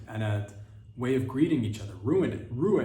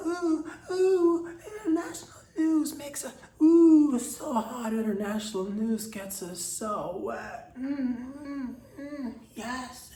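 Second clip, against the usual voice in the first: very high, squealing fake arousal; over-the-top orgasmic falsetto; mock moaning ecstasy; 12 semitones higher, 5 dB louder, slower than usual. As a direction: moan it in a hammed-up falsetto, easing down at the end. Mock arousal over international news.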